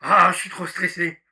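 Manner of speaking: loud mock wail of stress; loud hammed-up stressed cry; stressed outburst. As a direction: blurt out the stress. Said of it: "qu'est-ce qu'il va faire".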